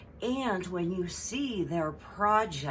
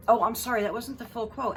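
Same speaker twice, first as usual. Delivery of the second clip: loud mock oops; sarcastic apology; 5 semitones higher, 5 dB louder, faster than usual. Vlogger cutting in with a fake sorry.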